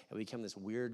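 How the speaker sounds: usual speaking voice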